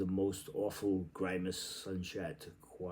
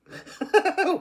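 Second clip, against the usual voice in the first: very high, loud burst of laughter; a shrieking laugh; high cackle; 11 dB louder than usual. Acted. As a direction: cackle wildly. Wild laugh during reading.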